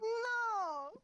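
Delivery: high, loud drawn-out wail; dismayed wail. Pretend. Co-player hearing the accent was dropped, loud dismayed wail. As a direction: cry out in mock heartbreak.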